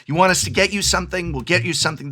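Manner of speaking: put-on terrible Italian accent. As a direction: have another clumsy go at an Italian accent. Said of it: "I'm doing a terrible".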